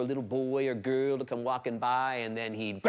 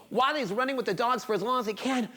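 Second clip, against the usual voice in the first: usual speaking voice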